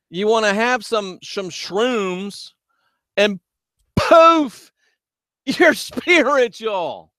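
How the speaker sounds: high, mocking sing-song about easy fixes. Real, not acted. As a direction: ridicule the shortcut to enlightenment. Mocking quick spiritual fixes.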